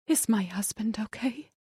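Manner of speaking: breathless whisper; wife whispering to the detective. A narrator's reading. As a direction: whisper the question in fear. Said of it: "she whispered".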